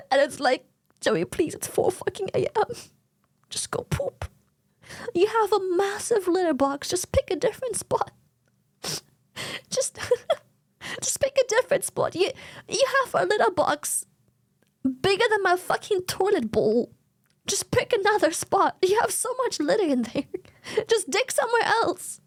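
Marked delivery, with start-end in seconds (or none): 1.0-2.7 weary plea climbing high; exasperated pleading. Acted. Pleading with cat at night. 3.5-4.2 breathless exasperated whisper; whispered plea. Acted. Pleading with cat at night. 5.1-22.2 high-pitched incredulous pleading, half laughing; exasperated pleading. Acted. Arguing with fussy cat.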